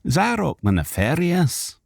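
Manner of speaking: usual speaking voice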